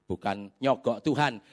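ordinary delivery

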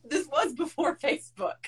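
high, laughing excuse; laughing defense. Retold or imitated. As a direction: giggle through the excuse. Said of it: "I was like".

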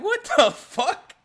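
high, loud, drawn-out disbelief; loud, stretched-out, baffled exclamation; incredulous outburst. Retold or imitated. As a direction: cry it out in exaggerated shock. Acted outraged reaction to checkpoint question.

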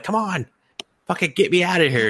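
high-pitched, hurried cat voice; pleading cat. Retold or imitated. A cat stuck between buildings demands help, high and urgent. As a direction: voice an impatient cat begging for rescue. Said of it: "and the cat's like".

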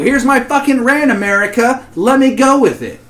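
loud, high hammed-up tirade; over-the-top fake outrage; mock rant. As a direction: rant like a man who's been wronged. Mock rant after ranch joke.

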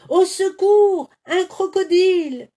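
high, loud, panicked cry; wolf screaming at a crocodile; terrified scream. A narrator's reading. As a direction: scream for help in fright. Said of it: "hurla Lou".

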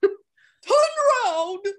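over-the-top command to the driver; drawn-out shout. Retold or imitated. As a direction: stretch the shout out, half laughing. Repeated urgent command.

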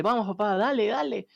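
high-pitched imitation of a cheering boy; retold cheering on. Boy cheers on his fighting father.

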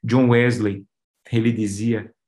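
normal talking voice

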